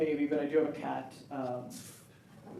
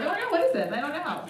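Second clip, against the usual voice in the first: put-on confused girls; 7 semitones higher than usual. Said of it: "So girls are like".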